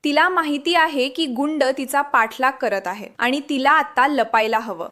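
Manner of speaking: ordinary delivery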